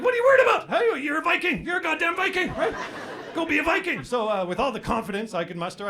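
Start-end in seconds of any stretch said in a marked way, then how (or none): 0.0-4.0 high, loud self pep talk, building himself up. Retold or imitated. Pep talk to himself in the car mirror, high and hyped. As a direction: pump yourself up like a Viking. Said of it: "giving myself a pep talk".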